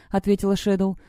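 ordinary delivery, nothing marked

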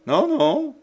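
brisk. Acted. Marie quickly refuses Jeanne's offer of help, high and brisk. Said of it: "fit vivement Marie".

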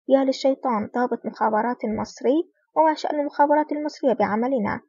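ordinary delivery, nothing marked

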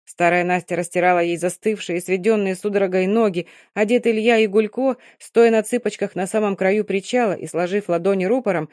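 ordinary delivery

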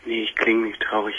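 usual speaking voice

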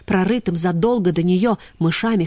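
ordinary delivery, nothing marked

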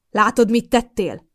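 slow, loud, scornful reproach; contemptuous. Acted. Mother scornfully blames daughter for the screaming son. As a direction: blame her with open contempt. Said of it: "bámult rám megvetően anyám".

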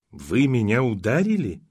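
usual speaking voice

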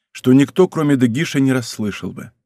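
plain reading voice

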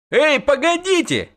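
very high call to wait; high pleading call. A narrator's reading. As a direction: call after the riders. Dandelion calls to wait.

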